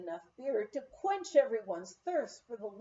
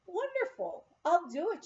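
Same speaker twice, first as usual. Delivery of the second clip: much higher, bright burst of enthusiasm on Wonderful; eager agreement; 8 semitones higher, 3 dB louder than usual. Retold or imitated. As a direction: jump at the idea like a delighted guest. Guest journalist eagerly agrees.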